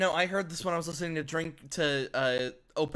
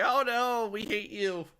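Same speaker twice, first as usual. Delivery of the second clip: mock whiny children; 6 semitones higher than usual. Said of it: "the children are just like".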